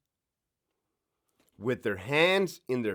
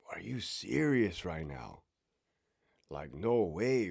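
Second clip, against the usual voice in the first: slow, softer unvoiced whisper of disbelief; whispered inner voice; 6 semitones lower, 7 dB quieter, slower than usual. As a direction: breathe it like a stunned thought to yourself. Inner voice of racing mind.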